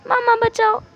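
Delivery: put-on high child's cry for help; child calling for rescue. Child fleeing to mother after biting father, high. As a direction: squeal for mother in play-acted alarm. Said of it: "वहाँ से भागते हुए".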